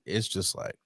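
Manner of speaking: usual speaking voice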